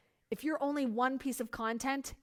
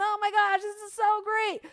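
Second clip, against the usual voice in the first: mock gush, higher and louder; hype seller; 7 semitones higher, 4 dB louder than usual. Mocking a hyped seller with confetti cannons, higher and louder. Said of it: "confetti cannons".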